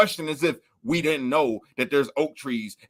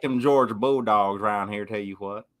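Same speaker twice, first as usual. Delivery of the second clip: put-on Alabama accent; 6 semitones lower than usual. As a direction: lower Southern Alabama twang. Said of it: "This one right here is my Alabama voice".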